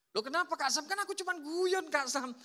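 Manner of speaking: high, whiny protest; defensive youth. Retold or imitated. Church youth defending his joke to Kak Sam, high and whiny. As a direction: protest that it was only a joke.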